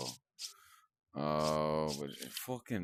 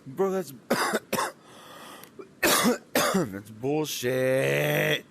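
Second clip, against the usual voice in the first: loud, high, dragged-out outraged yell; real rage shout; furious yell; 7 semitones higher, 7 dB louder, much slower than usual. Real, not acted. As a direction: scream it at the screen. Raging at game death.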